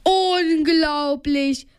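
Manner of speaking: slow, stretched-out exclamation; storyteller's wondering cry; amazed call. A narrator's reading. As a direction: draw the word out in amazement. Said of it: "rief Bob Justus zu".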